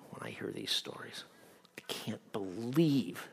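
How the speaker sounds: whispered, soft confession of grief. Real, not acted. Preacher whispering his grief over abused kids. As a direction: breathe it out in sorrow.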